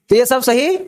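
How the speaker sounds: normal talking voice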